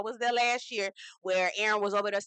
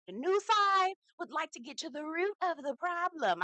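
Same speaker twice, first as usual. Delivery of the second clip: mock prim voice; 6 semitones higher than usual. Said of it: "She was like".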